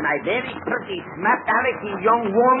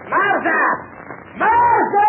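calling out; 10 semitones higher, slower than usual. Acted. Sing Sing summons Martha, building to a shout. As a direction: bellow for the servant across the camp. Sing Sing calls his servant.

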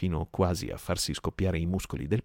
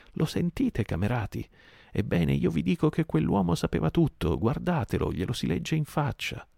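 rousing the crew; 10 semitones higher than usual. Acted. Merry turns the crew on Silver, high accusing rant. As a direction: whip the crew up against him.

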